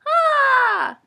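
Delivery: high, loud delighted cry; a sudden squeal of delight; excited shout. Real, not acted. Vlogger squeals at what she got for viewers.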